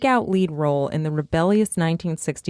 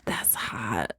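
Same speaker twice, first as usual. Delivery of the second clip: a hushed whisper, lower; 7 semitones lower, 9 dB quieter than usual. Real, not acted. Host reacting to the memoir line, whispered. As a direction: breathe it like a confession.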